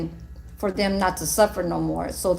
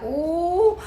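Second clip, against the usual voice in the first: high mock ooh; 8 semitones higher than usual. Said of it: "I said, I was like".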